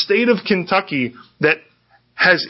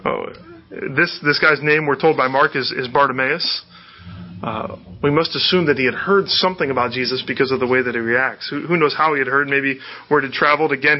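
ordinary delivery

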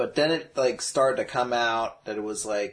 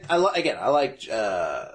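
ordinary talk

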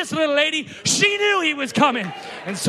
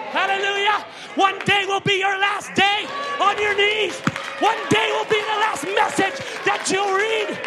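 preaching shout; 6 semitones higher than usual. Acted. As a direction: build to a fiery climax. Preaching climax.

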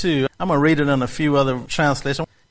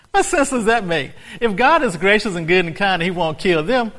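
ordinary talk, nothing marked

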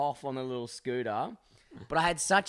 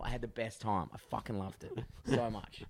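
usual speaking voice

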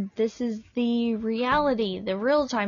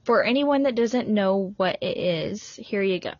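ordinary talk, nothing marked

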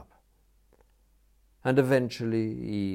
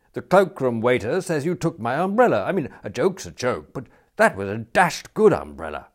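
lightly voiced, louder indignant complaint; indignant youth, protesting; 4 semitones higher, 8 dB louder than usual. Youth accuses Smith of taking umbrella, indignant. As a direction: protest like an affronted club youth.

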